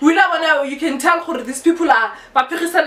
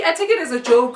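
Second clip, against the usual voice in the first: normal talking voice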